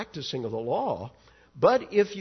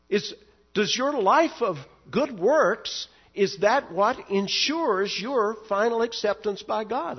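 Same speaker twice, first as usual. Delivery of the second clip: rising, louder preacher's questions; impassioned sermon questions, higher and louder; preaching; 7 semitones higher, 4 dB louder than usual. Acted. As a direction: press the questions home from the pulpit.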